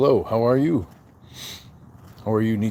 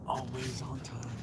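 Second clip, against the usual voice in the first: slow, near-whispered, much softer repeat; hushed echo; 14 dB quieter than usual. Real, not acted. Streamer echoing his patience motto to chat, hushed and slow. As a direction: let the words fade to a breath.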